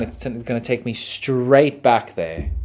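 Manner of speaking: ordinary talk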